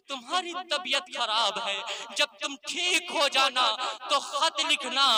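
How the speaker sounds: ordinary talk, nothing marked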